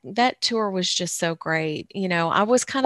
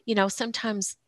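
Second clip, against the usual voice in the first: ordinary talk, nothing marked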